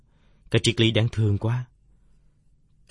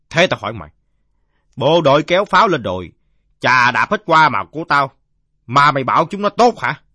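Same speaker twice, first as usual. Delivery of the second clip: loud, raised-voice grievance; aggrieved drunkard; 6 semitones higher, 12 dB louder than usual. Acted. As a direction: rail at the kid like a wronged drinker. (giọng ông say lớn tiếng kể tội)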